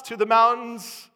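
loud, building sermon delivery; full-force preaching. Real, not acted. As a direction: pile up the examples with mounting volume.

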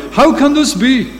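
Abraham answers God's promise, high and incredulous; Abraham doubting. Acted. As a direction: cry out in disbelief. Preacher voices doubting Abraham.

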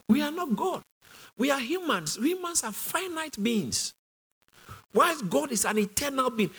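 high-pitched, emphatic sermon delivery; impassioned preaching. Real, not acted. Pastor tells congregation God is beyond comprehension, impassioned preaching.

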